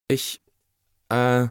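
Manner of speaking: halting, with long gaps; caught-out child's stammer; hesitant stammer. A narrator's reading. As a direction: falter after each word, searching for an answer. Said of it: "stotterte Anna".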